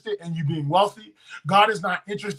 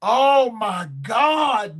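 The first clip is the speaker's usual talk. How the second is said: preacher's shout; 3 semitones higher, much slower than usual. Real, not acted. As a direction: let it burst out slowly. Preacher erupts mid-sermon.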